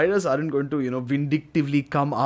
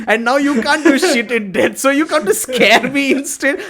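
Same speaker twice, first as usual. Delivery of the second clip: higher, slow, louder jeering at a ghost; mocking taunt; 7 semitones higher, 3 dB louder than usual. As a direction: laugh down at the ghost, scornful and slow. Taunting a ghost in imagined scene.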